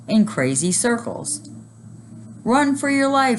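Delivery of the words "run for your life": high, loud alarm; panicked shout. A narrator's reading. Neil warning about the loose reindeer, loud alarm. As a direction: warn everyone, panicking. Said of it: "shouted Neil".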